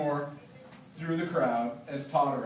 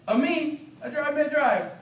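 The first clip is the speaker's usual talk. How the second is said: much higher, louder, joyful Jamaican greeting; delighted Biga; 10 semitones higher, 5 dB louder than usual. Acted. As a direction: call out to an old friend in surprise. Biga answers in patois.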